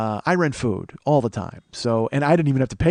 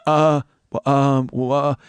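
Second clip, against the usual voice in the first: halting, lost-for-words mock fluster; flustered stammer; 4 dB louder, slower than usual. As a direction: imitate a caught-out man stammering. Flustered man caught with fries.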